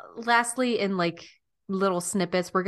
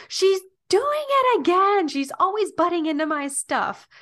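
much higher, louder mock-whiny complaint; playful sisterly whine, pitched way up; Sandra Bullock impression; 11 semitones higher, 7 dB louder than usual. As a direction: tease like a grumpy sister, up high. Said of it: "Sandra Bullock goes".

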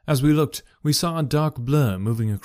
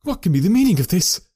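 louder, higher bewildered demand; stunned demand; 6 semitones higher, 5 dB louder, faster than usual. A narrator's reading. Husband demanding an explanation, louder and stunned. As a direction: demand an answer in shock.

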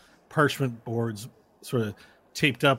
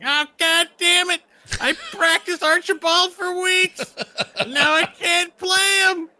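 much higher, louder frustrated halfling whine; 7 dB louder, slower than usual. Acted. Halfling complaining to the crowd, high frustrated whine. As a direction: whine like a squeaky cheated halfling. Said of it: "all halflings sound like that".

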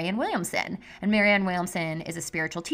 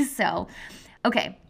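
normal talking voice

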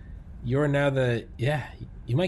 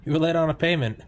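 raised-pitch mock mobster taunt; menacing mobster; 6 semitones higher, 3 dB louder than usual. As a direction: taunt him about the late payment.

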